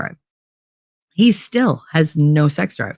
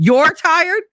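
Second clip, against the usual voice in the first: indignant repeat; 12 semitones higher than usual. Retold or imitated. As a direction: throw his words back in disbelief. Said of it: "I was just mad".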